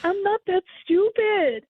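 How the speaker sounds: slow, high-pitched indignant retort; angry retort. Retold or imitated. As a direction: spell it out slowly, offended. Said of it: "I got really angry".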